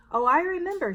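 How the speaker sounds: a cheery librarian who remembers. A narrator's reading. Librarian replies warmly.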